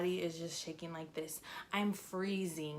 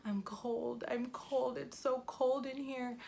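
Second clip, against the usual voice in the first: higher, plaintive "I'm cold"; shivery complaint; 5 semitones higher than usual. Retold or imitated. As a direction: whimper it like you're freezing. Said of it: "I keep telling my mom".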